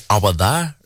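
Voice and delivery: rough Geordie attempt at "awa there". Shown as a demonstration. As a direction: turn "over" into "awa", Geordie style. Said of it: "like Geordie people say ... they say".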